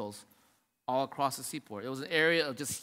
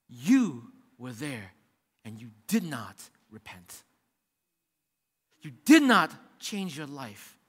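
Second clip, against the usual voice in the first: intense preaching; 5 semitones lower than usual. Acted. Pastor confronts the unrepentant cities in intense preaching. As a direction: press the accusation home. Preaching judgment, drops low.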